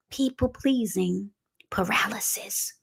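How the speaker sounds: hushed, slow whisper of the key phrase; dramatic whisper. Acted. Preacher whispering his coined phrase to the congregation, hushed. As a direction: let it drop to a breathless whisper for effect.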